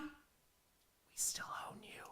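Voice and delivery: taunting whisper. Acted. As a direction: breathe the taunt, no voice. Taunt whispered after intro.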